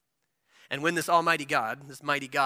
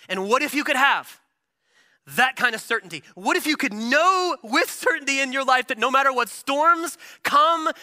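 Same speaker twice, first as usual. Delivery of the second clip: preaching at full force; 7 semitones higher, 5 dB louder than usual. Acted.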